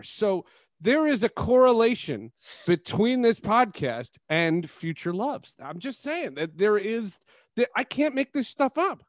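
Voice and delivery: slow, high playful emphasis; excited. Real, not acted. Gleeful punchline about matchmaking.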